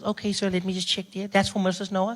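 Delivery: a hint of a polite pharmacy clerk.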